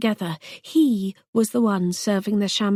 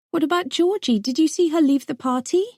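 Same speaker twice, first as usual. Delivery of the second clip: urgent high plea; 8 semitones higher, faster than usual. Acted. Pleading for her missing friend.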